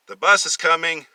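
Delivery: loud, high urgent call. Acted. Brother calling out a warning as the bus comes. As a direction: warn him fast.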